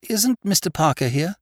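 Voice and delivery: high, loud, abrupt question; woman's voice suddenly piping up. A narrator's reading. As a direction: blurt it out from nowhere. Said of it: "She asked suddenly, apropos of nothing".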